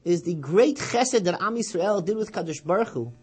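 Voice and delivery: loud, high fervent proclamation; impassioned preaching. Acted. Preacher voicing grandfather's teaching loud and high. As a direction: preach it with full force. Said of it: "He said".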